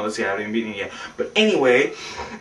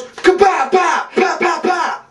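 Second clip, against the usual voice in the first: high, loud shouted pows; mock gunfire; 13 semitones higher, 4 dB louder, slower than usual. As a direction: fire off shots with your mouth. Imitates gunshots.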